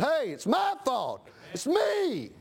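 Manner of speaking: slow, rising confession, high on me; Jonah confessing. Acted. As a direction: own the blame, slowly.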